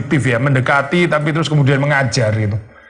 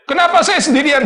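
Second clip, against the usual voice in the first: much higher-pitched mock complaint; mock whining yell; 13 semitones higher than usual. Preacher mimics a church volunteer complaining of working alone, mock whining yell. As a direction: imitate a complainer crying out in a high voice. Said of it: "terus saudara teriak-teriak".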